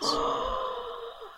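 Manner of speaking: sharp high gasp of delight. Real, not acted. Delighted gasp at angel idea.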